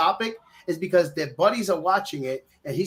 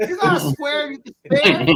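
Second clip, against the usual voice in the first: put-on higher, slow Spanish-accented street voice; Scarface accent; 7 semitones higher, 4 dB louder than usual. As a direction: play it like Scarface asking for a smoke. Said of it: "I'll sound like this".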